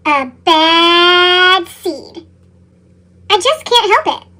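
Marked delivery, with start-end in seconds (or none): 0.1-2.3 very slow, heavy and ominous; a slow, brooding repeat; dramatic slow echo. A narrator's reading. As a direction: drag each word out darkly.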